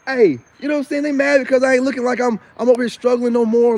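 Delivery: hyped shout-out to friend Moon; excited greeting. Real, not acted. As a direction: call out to a friend happily. Greeting his friend Moon on camera.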